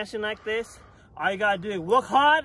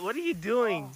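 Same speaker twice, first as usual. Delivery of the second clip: ordinary delivery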